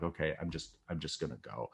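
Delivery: low, very soft, giving up; resigned. Retold or imitated. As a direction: murmur it low as if to yourself. Giving up after long wait.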